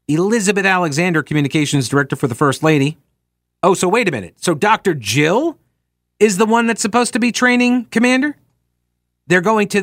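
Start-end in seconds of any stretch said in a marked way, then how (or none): none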